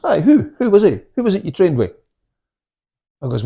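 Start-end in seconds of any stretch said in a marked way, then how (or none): 0.0-1.9 retold insistent questioning, high and louder; pointed, higher-pitched demand in a retelling; pressing interrogation. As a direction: press him for the name. Retold grilling of a boastful man.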